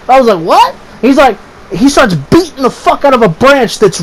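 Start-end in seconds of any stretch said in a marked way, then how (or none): 0.4-0.7 high-pitched mock "what"; startled reply. Me answering my friend under the tree, high startled reply. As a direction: shoot back a high, puzzled reply.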